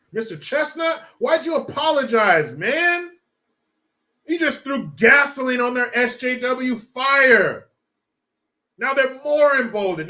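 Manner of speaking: loud, slow, angry shout; exasperated shout. Real, not acted. Shouting at the teacher for apologizing, angry and loud. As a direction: rail at him at full volume.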